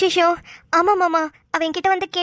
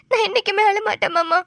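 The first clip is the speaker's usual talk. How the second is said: normal talking voice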